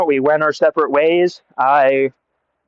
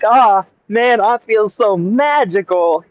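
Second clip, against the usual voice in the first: giddy wonder; 6 semitones higher, slower than usual. Retold or imitated. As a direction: float the words up like you're blissed out. High on weed, skipping gaily.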